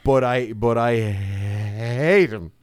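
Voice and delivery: HATE drawn right out, very slow; emphatic hatred. Real, not acted. Podcaster declaring his loathing of a film character, emphatic. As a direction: lean long and hard on "hate".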